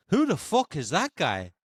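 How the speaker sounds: terrible Irish accent, swooping mock-incredulous question; Conor McGregor. Quoting a fighter about an unknown player, terrible Irish accent. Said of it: "to quote Conor McGregor".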